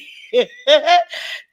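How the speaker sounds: loud high burst of laughter. Real, not acted. Laughs at a viewer's comment.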